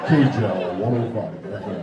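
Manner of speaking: deep, loud radio announcer station ID; radio jingle voice. Retold or imitated. Joking the name sounds like a station, booming announcer voice. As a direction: boom it like a cheesy FM jingle. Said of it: "It sounded like a Swedish radio station".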